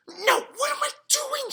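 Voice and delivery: sudden high alarmed cry; panicked inner cry. A narrator's reading. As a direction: catch himself in horror. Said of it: "he thought".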